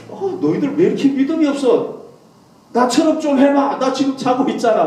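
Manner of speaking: high, exasperated mock telling-off; mock scolding. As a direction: scold them as if woken from sleep. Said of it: "나 같으면 그랬을 것 같아요".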